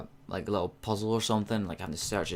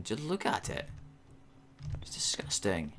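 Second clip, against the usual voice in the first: hushed, appalled whisper; disgusted whisper; 7 dB quieter than usual. Real, not acted. Disgusted at the messy kitchen.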